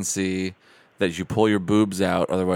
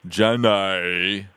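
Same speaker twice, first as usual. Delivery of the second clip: Forrest Gump voice. Retold or imitated. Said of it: "Forrest Gump's always like".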